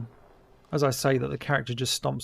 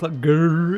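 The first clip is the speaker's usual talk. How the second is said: growl; 3 semitones higher, 9 dB louder than usual. Retold or imitated. Mimics the monster's clumsy walk.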